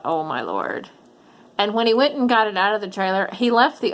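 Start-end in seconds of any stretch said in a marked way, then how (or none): 0.0-0.9 low, soft exasperated sigh of words. Retold or imitated. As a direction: mutter it under your breath, low. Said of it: "I just thought".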